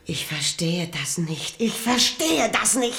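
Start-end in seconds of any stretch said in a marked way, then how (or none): 1.6-3.0 higher, louder, more urgent; agitated repeat. Acted. Queen repeating it to the king in agitation. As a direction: push the line out in rising disbelief.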